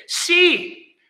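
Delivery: high, loud, sudden "See"; commanding call. Acted. Preacher voicing God to the doubting, commanding call. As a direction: call it out to stop people and make them look. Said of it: "is a demonstrative word".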